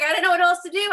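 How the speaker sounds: loud, high excuse in a retelling; retold self-defence. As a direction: defend yourself, pitch and volume up. Acted defence to her dad.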